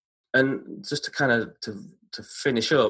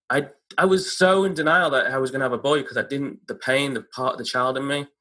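normal talking voice

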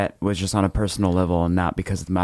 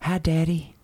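put-on little boy; 9 semitones higher, slower than usual. Boy greets father in story.

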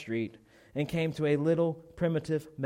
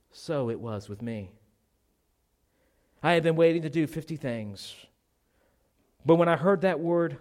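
ordinary talk, nothing marked

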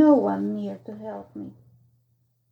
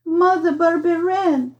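distressed calling out; 5 semitones higher than usual. A narrator's reading. Boy calls for Mother Barberin, distressed calling out. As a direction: cry out to a mother, pleading.

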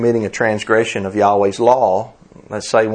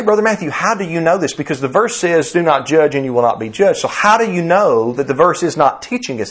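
high-pitched imagined objector; challenging objection; 6 semitones higher than usual. Acted. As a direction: press the preacher with a raised, questioning voice. Voices a listener's challenging objection.

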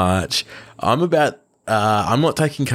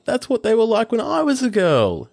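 high, delighted exclaim; excited exclamation; 13 semitones higher than usual. Acted. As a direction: exclaim it like a thrilled grandmother. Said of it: "a grandmother saw it and exclaimed".

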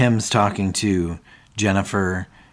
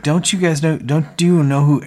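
exasperated; 7 semitones higher, 5 dB louder than usual. Retold or imitated. David ranting at silent crowd.

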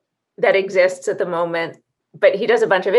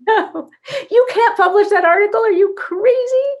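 much higher, louder incredulous voice through a laugh; laughing mock alarm; 6 dB louder than usual. Inner voice stopping a reckless article, high laughing mock alarm. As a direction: play the panicked inner editor, laughing.

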